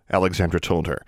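ordinary delivery

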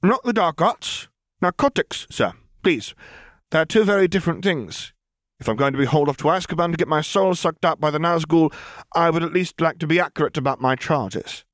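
a lifted, amused female voice; Lily's voice. A narrator's reading. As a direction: correct the charge with dry poise.

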